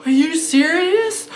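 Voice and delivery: high, loud, slow disbelief; stunned kid. Retold or imitated. Imitating the kid looking at the hand, stunned disbelief. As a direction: imitate an appalled child, drawn out.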